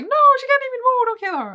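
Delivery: high, loud, fast desperate plea; frantic high begging shout; pleading cry. Retold or imitated. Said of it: "And then Jill is like".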